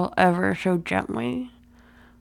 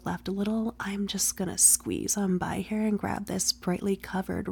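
soft teasing whisper; breathy ASMR whisper; 5 dB quieter than usual. Acted.

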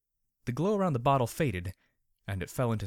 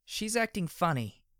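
suspicious; 9 semitones higher than usual. Acted. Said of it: "Medley said suspiciously".

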